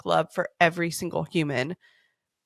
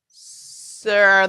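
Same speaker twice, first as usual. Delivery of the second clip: louder, stretched-out taunt; teasing drawn-out start; 7 dB louder than usual. Retold or imitated. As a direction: drag it out to wind them up. Teasing family with her catchphrase.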